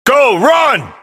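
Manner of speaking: very high, shouted order; urgent yell. Acted. Ordering someone to flee now, urgent yell. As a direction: yell it to send them fleeing.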